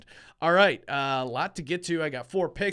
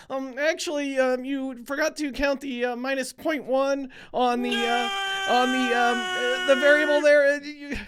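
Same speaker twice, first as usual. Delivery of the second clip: high, nasal-sounding know-it-all correction, pitched way up; nitpicking unit police; 7 semitones higher than usual. Retold or imitated. Host mocking the unit police who nitpick his record, nerdy high voice. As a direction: play the pedantic stat nerd butting in. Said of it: "hopefully the unit police stay off my back".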